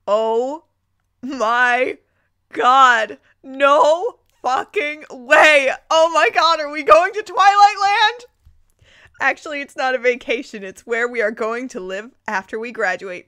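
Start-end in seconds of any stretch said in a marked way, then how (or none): 0.1-8.2 very loud, high fangirl scream; excited screaming. Real, not acted. Reader screaming at the Twilight twist, overjoyed shriek. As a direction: shriek it like a fan who can't believe it.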